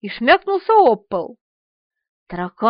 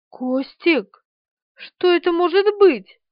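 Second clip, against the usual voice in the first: a small squeak, rising higher; mildly acted frozen-in-horror question; terrified squeak; 5 semitones higher, slower than usual. A narrator's reading. Frightened woman asks Kostik what is crawling, squeaking. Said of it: "пропищала она, цепенея от ужаса".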